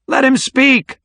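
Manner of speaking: high, loud heckle from a crowd; shout. A narrator's reading. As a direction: yell it from the back of the room. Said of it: "someone shouted".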